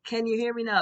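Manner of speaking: loud, high mock phone-check call; Verizon commercial. Reader making sure the Divine Feminines hear her, commercial-style call-out. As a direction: project it like an ad catchphrase. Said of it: "It's like the Verizon commercial".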